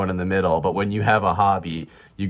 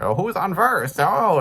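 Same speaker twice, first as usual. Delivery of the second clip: high, animated comedy-duo banter; comedy routine voice; 12 semitones higher, slower than usual. Retold or imitated. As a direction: rattle it off like an old comedy act. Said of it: "Abbott and Costello".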